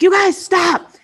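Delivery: put-on sharp high yell of "stop" at the kids. Mom showing how she yells at her fighting kids, loud and high. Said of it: "like, a scream at them".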